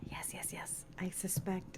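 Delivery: unvoiced teasing whisper. Real, not acted. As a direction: whisper a sly aside.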